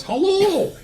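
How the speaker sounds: high, drawn-out Scottish hello; Scottish accent. Retold or imitated. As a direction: call out a greeting in a Scottish accent. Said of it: "he said in Scottish accent".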